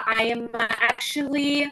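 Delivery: normal talking voice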